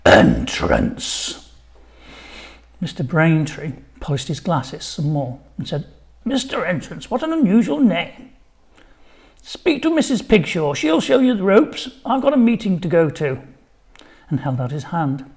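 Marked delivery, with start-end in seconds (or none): 0.0-1.5 very low, loud growl; deep growling voice. A narrator's reading. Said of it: "in a deep, growling voice". 6.2-13.4 high, fussy head teacher; chirpy busy headmaster, higher pitch. A narrator's reading. Headteacher voiced in story.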